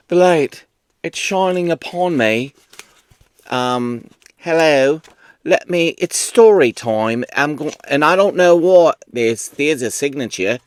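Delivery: hammed-up Australian-ish accent; theatrical botched Australian accent; muddled mock accent. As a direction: jumble countries into one accent, roughly Australian. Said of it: "a number of countries just collided in my".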